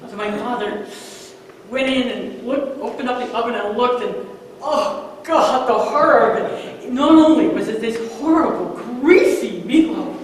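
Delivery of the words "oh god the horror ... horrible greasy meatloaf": mock horror. Describing the fish-shaped meatloaf.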